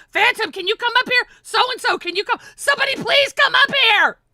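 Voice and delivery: high, loud begging call; mock desperate pleading. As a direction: beg everyone to come up, hammed-up. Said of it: "they're praying for".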